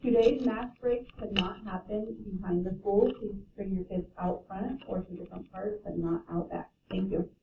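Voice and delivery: distant PA announcement; school intercom. Real, not acted. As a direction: voice through a school loudspeaker. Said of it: "sorry about that announcement right there".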